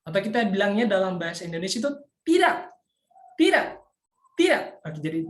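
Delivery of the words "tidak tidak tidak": sharp, high TIDAK with an abrupt fall; fourth tone TIDAK. Shown as a demonstration. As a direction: bark a short TIDAK as if scolding someone. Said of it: "seperti kita membentak seseorang".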